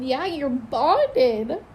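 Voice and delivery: slow, tearful and moved; crying, moved. Real, not acted. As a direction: choke up over the news. Emotional reaction, crying heard.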